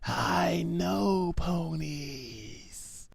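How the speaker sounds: slow, soft whispered secret. A narrator's reading. Pinkie whispering into Applejack's ear, slow and soft. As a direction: breathe it into her ear.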